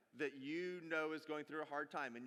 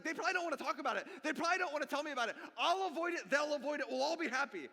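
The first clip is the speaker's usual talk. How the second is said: fast, higher, louder rattle of excuses; mock avoidant inner voice; 8 semitones higher, 6 dB louder, faster than usual. Pastor mocking how the congregation dodges others' pain, fast and high. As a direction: rush through the excuses, hammed-up. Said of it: "We're like".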